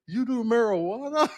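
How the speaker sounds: high-pitched incredulous holler; mock yelling. Customs officer questioning the traveler, mock yelling. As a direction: imitate an official calling it out in disbelief. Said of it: "the guy's yelling like".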